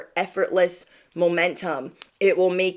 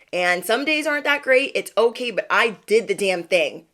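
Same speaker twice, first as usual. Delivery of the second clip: ordinary delivery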